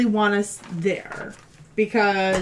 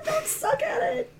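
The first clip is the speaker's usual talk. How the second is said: shouting at dice; 6 dB quieter, slower than usual. Real, not acted. Pleading with the dice roll.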